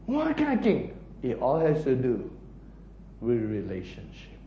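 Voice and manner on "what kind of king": ordinary talk, nothing marked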